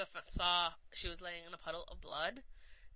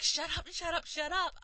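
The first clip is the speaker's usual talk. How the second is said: retold screaming; 6 semitones higher than usual. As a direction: scream at him to shut up and go, as a retelling. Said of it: "she's just screaming at him".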